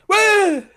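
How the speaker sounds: high-pitched, drawn-out baffled "woo"; high questioning squeal of confusion; confused waiter's "woo". Retold or imitated. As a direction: imitate a baffled man with one high hoot. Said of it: "he was quite confused".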